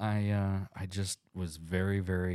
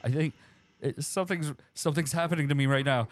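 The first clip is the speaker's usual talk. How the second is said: mock panic; 6 semitones higher, faster than usual. Turning into monster.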